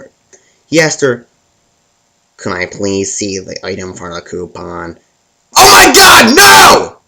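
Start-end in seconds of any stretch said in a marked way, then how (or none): 2.4-5.0 low, soft, slow cashier voice; polite cashier. Retold or imitated. Tommy asks customer politely. 5.6-7.0 high, loud, exasperated mock yell; hammed-up furious customer; angry outburst. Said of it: "she said angrily".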